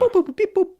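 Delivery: high squeaky droid beeping; robot beeps. Pretend. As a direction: beep like a little robot. More R2-D2 beeping.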